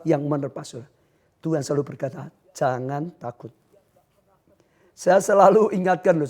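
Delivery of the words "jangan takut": calm reassurance. Acted. God always says this.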